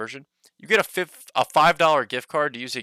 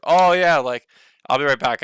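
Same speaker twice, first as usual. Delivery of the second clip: excited; 3 semitones higher, 9 dB louder than usual. Retold or imitated. Excitedly quoting himself at GameStop.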